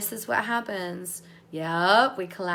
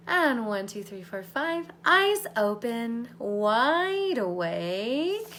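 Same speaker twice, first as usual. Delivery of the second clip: louder, drawn-out wake-up call; waking count; 5 dB louder, much slower than usual. Acted. Coach counting the group back awake, louder and drawn out. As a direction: bring them up and out, slowly.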